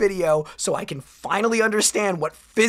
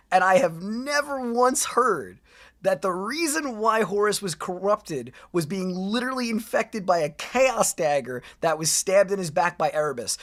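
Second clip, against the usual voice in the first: normal talking voice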